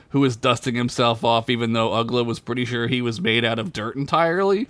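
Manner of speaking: ordinary talk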